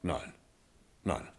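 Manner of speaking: ordinary talk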